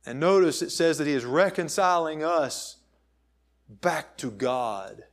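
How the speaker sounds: normal talking voice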